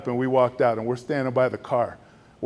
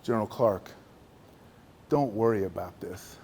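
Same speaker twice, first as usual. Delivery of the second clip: softer, lower, reassuring; fatherly comfort; 4 semitones lower, 6 dB quieter than usual. Retold or imitated. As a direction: console him with an arm around him. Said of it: "puts his arm around me".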